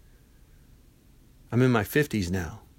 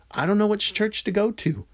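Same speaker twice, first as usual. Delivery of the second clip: higher-pitched mock objection; raised-pitch imitation of a doubter; listener's objection; 9 semitones higher than usual. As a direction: voice a helpless listener's excuse.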